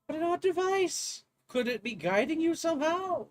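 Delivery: put-on curious English-accented academic; professor accent. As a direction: wonder aloud in a posh English accent. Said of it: "this accent".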